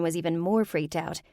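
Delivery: ordinary delivery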